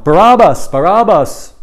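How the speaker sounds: imitated chant-like shout, high and loud. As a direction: call it out like stirring up a crowd. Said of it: "probably start shouting out".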